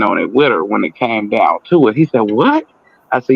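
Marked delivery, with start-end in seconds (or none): 2.3-2.7 sharp high startled what; shocked what. Retold or imitated. Barber's client reacting in shock, high startled what. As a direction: jump up in shock at the news.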